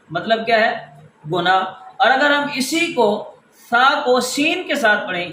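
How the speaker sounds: normal talking voice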